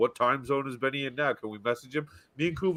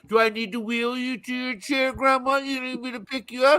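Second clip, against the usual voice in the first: high-pitched mock scolding; imitating rude waitress; 8 semitones higher than usual. Waitress ordering grandma to her chair, acted insolence. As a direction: berate granny in a put-on higher voice. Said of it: "just arrogant and rude to you".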